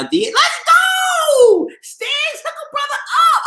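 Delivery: shrieking high, drawn-out cheer; ecstatic shout. Real, not acted. Thrilled fan shrieking at Stiggs for an interview.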